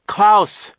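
high, loud call of a name; urgent cry. A narrator's reading. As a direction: call out to a friend in danger. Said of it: "Duncan cried".

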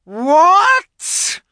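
high, loud furious roar; witch's roar. A narrator's reading. Witch roaring at the fox's answer. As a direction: explode with outrage. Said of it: "roared the witch".

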